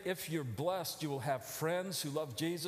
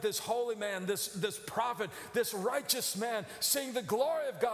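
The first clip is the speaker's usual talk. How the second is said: ordinary talk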